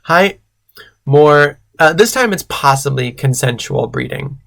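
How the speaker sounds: ordinary delivery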